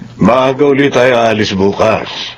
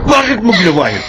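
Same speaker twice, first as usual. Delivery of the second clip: sharp, raised angry question; angry demand; 8 semitones higher than usual. Acted. Angry father scolding his daughter, raised voice. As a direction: confront her hard.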